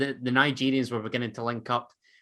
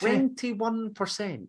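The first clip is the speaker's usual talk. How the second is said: high, drawn-out disbelief; incredulous echo; 9 semitones higher, much slower than usual. Real, not acted. Co-host reacting to a shocking possession stat, incredulous. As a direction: slowly repeat it, pitched up in astonishment.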